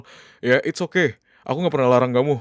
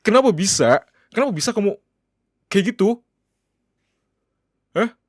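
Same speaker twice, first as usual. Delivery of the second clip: higher, louder angry questions; angry demand; 8 semitones higher, 5 dB louder than usual. Acted. Husband confronting his wife over the club night, angry. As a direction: demand answers, voice rising.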